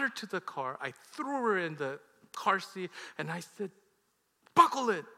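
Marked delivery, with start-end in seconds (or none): 4.5-5.1 loud, high barked command; acted furious bark; angry order. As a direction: snap the retold order sharply. Retold angry order to daughter.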